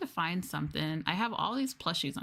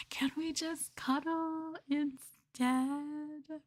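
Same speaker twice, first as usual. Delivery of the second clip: high, drawn-out cutesy voice; slow cute plea; 10 semitones higher, much slower than usual. Acted. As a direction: beg sweetly and very slowly.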